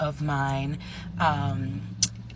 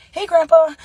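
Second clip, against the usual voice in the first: high, loud call to grandpa; bright phone greeting; 13 semitones higher, 12 dB louder than usual. Retold or imitated. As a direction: sing out a hello, much higher.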